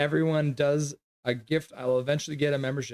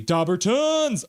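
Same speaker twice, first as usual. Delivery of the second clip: higher, drawn-out, louder, stretched slowly; excited pet name call; 7 semitones higher, 4 dB louder, slower than usual. Real, not acted. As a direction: sing out the dog's nickname with delight. Calling her dog by pet name.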